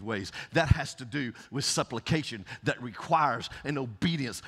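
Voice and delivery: full-force sermon delivery; fiery preaching. Acted. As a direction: preach it to the rafters.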